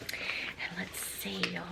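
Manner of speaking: hushed, slow whisper to herself; whispered aside. Real, not acted. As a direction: breathe it out, slow and secret. Peeking at the gift card.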